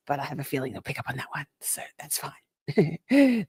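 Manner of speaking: whispered aside, then slowed right down. Acted. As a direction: hush it so the AI won't hear. Sneaking past the YouTube AI.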